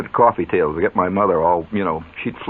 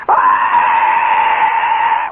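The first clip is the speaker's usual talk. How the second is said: loud breathy cry of disgust; mock outcry; 6 dB louder than usual. Mother bites the soap chocolate, loud breathy outcry. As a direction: gag and cry out at the taste.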